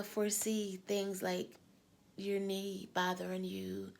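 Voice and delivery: normal talking voice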